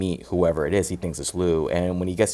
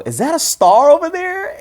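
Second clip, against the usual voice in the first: very high, put-on questioning voice; Nancy Lee; 4 dB louder than usual. Podcaster voices a film character spotting a star, high mock voice. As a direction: squeak the question out as if starstruck.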